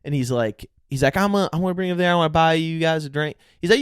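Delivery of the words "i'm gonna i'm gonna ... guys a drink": mock Southern gentleman, pitched up. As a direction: imitate an Alabama guy offering drinks. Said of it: "a Southern gentleman".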